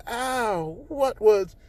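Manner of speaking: high, startled ow in an odd voice; reeling from the bounce; dazed Uraraka. Acted. Uraraka knocked back, dazed.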